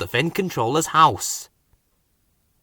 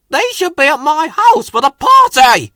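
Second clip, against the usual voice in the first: much higher, much louder furious shout; angry shout; 13 semitones higher, 10 dB louder than usual. A narrator's reading. Controller finding party cargo at the wharf, furious shout. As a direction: yell it, outraged. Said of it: "the Finn controller shouted".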